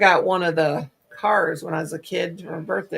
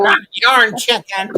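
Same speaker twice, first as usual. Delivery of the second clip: mock dismay; 4 semitones higher, 7 dB louder, slower than usual.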